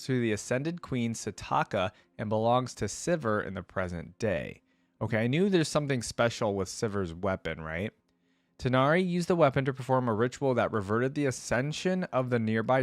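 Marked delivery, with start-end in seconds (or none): none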